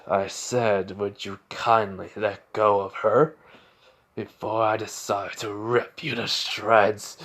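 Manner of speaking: a quiet, deadly warning; menacing threat. Acted. Radio demon warns the sludge villain, low and slow. Izuku repeats demand with menace.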